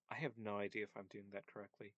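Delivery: usual speaking voice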